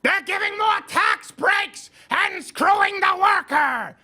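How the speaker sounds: high, slow mock shouting of a leftist; shrill mock protest shout; mocking Bernie rant. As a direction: ham up a ranting socialist's outrage. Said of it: "he wrote this socialist screed".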